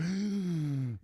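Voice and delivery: a loud sudden intake of breath; sharp mock gasp. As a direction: gasp as it finally hits you. Acting out the realization.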